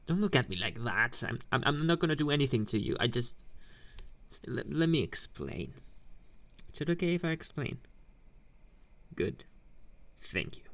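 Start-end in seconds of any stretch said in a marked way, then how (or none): 1.3-2.9 fast, stumbling "I'm, I'm, I'm"; rushed reassurance. Acted. Hastily reassuring the scared nerd. 6.7-7.8 quick nervous ask. Acted. Asking nervously to explain.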